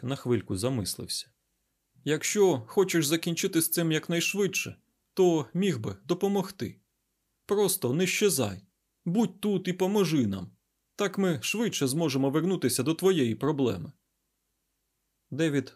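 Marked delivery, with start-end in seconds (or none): 2.0-13.9 scientist persuading, higher voice; earnest, lifted-pitch appeal; Айзек. Acted. Scientist asking the garuda to stay and help, persuasive.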